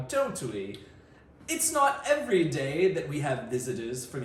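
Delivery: a hint of a jolly British dad, high on the catchphrases; lightly hammed British accent. Dad welcomes the visiting cousins in mock British. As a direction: keep the dad's mock British going. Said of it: "in his best British accent".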